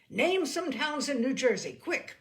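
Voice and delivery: loud, pressing urgency; urgent demand. A narrator's reading. Narrator voicing urgent demand.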